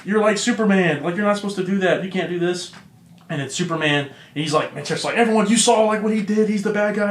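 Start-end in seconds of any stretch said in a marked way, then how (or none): 0.0-2.7 high-pitched mock pleading, no sobs; retold crying protest. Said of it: "starts crying". 5.2-7.1 high-pitched mock outcry; retold frantic appeal. Villain appeals to onlookers in panic.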